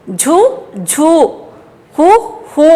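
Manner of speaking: "झू" and "हू" each said short, then held long. Shown as a demonstration. Teacher drilling children in vowel signs, short then long. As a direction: contrast a quick u with a drawn-out oo in "झू", "हू". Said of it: "ये छोटे की मात्रा और ये बड़े की मात्रा".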